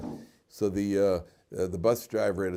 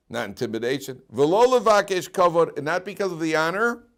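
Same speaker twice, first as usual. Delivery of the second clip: slow, higher, louder recitation; preaching; 7 semitones higher, 8 dB louder, slower than usual. Acted. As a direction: intone the text like a sermon. Preaching Rambam.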